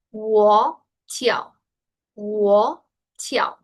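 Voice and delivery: "Wo Tiao" in two separated, careful syllables; pronunciation model. Shown as a demonstration. Teacher wanting students to repeat, careful pronunciation model. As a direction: model "Wo Tiao" word by word, pausing between. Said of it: "puedan entender justamente la pronunciación correcta".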